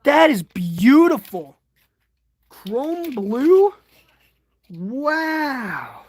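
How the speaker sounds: high, drawn-out admiration; awed delight. Real, not acted. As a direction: marvel at it, pitch swooping up. Admires the toy's paint.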